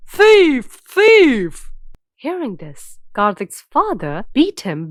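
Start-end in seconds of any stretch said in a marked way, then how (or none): 0.1-1.7 loud, high cry of accusation; high-pitched yell at a culprit; accusing shout. A narrator's reading. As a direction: shout it out twice to raise the alarm. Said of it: "and shouted".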